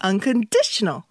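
overdone practice Unconditional; exaggerated stress. Shown as a demonstration. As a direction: stretch Unconditional, brows up on di. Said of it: "눈썹이 살짝 올라가도 좋을 것 같아요".

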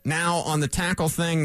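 ordinary delivery

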